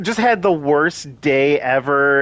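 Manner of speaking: normal talking voice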